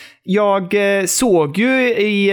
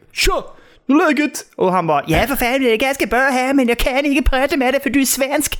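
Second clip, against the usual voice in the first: high mock foreign rock star; lightly voiced dismissive star; imitated band guy; 6 semitones higher than usual. Imagined star brushing off a Swede, voice raised. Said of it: "går du fram till Pelle Kavata".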